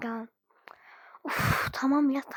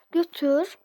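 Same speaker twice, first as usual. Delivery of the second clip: firm order; 3 semitones higher, 8 dB louder than usual. Acted. Mother ordering daughter to take kids.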